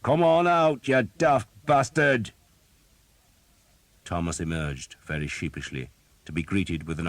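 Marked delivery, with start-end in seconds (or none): 0.0-2.3 loud calling shout across a field; rough soldier hollering for a hiding man. A narrator's reading. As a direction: bellow it toward the woods. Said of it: "shouted towards the woods".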